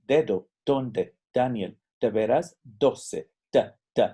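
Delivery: "Dedo", "dónde", "Daniel", "doce" said slowly, tongue tapping the palate; Spanish D words. Shown as a demonstration. Teacher has students listen to the Spanish D. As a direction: tap each D slowly against the palate. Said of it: "Escuchen mi español".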